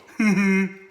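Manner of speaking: loud, high put-on giggle; mock laugh. Host laughs as the mocked viewer, loud mock giggle. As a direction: cap the heckling with a loud fake laugh.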